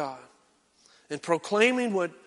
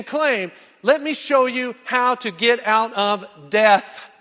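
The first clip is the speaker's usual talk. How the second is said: loud, higher preaching push; preaching hard; 5 semitones higher, 7 dB louder than usual. Real, not acted. Preacher presses the congregation about rest, full-force preaching. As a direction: drive it home to the pews.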